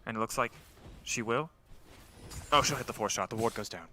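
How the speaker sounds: hushed, soft golf commentary; golf commentator. Acted. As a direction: murmur it like a golf announcer. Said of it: "like, a golf caster?".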